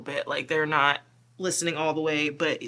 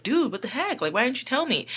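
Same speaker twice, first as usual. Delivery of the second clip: higher mock exasperated voice; exasperated partner; 7 semitones higher than usual. Frustrated partner asking why they never said, mock exasperated. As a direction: throw up your hands at them. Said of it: "you'll be like".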